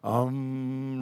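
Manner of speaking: low, soft drawn-out mantra hum; meditation hum. Retold or imitated. Preacher mimicking a street meditator's chant, low mantra hum. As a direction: imitate a meditator's quiet, low om. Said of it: "and I go".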